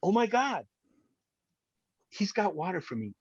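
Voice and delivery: high, hushed gasp of relief; as if you can't believe your luck; stunned disbelief. Retold or imitated. Survivor to the podcast hosts, recalling rescue, amazed hush. Retold inner thought, disbelief.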